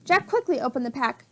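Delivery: usual speaking voice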